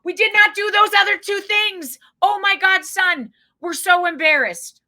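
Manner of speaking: high, loud flustered mom spelling it out; high, loud panicked mom over-explaining; frantic embarrassed mom. Retold or imitated. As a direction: push it out high and loud in mock mortification. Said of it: "she wrote out the word".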